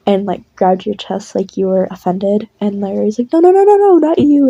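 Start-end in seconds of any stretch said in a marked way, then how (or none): 3.3-4.5 high, loud, rushed string of denials; frantic backtracking. Acted. Larry hurries to reassure offended listener, frantic protest. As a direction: blurt it out to take back the insult.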